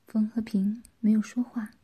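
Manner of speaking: usual speaking voice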